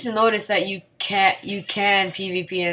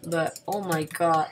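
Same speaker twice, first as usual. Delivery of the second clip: normal talking voice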